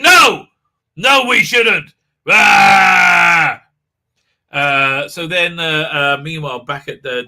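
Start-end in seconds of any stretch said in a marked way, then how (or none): 0.0-1.9 high, loud protesting yell; mock outraged refusal. Acted outburst against peaceful contact. 2.3-3.6 long, loud hammed-up scream; mock yell. Acted outburst ends in yell.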